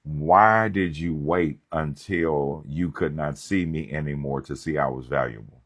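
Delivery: normal talking voice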